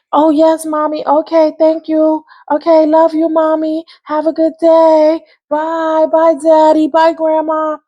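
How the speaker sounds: put-on high, sugary well-behaved child voice; sweet kid act. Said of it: "in front of your mother, you was just".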